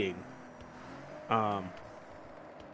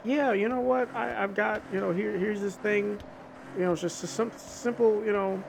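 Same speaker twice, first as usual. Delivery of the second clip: a lightly voiced patient God, higher; higher, friendly offer of guidance; God speaking; 9 semitones higher than usual. Retelling the Bible, voicing God offering guidelines, higher and friendly.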